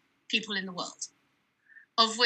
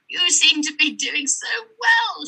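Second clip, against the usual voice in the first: higher, louder and slower, a mock-gushing academic; gushing dinner guest; 8 semitones higher, 5 dB louder than usual. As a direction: gush it at her like an overeager guest. Guest gushes at college dinner.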